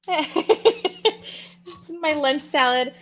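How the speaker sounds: laughing. Real, not acted. Laughing, showing her salad.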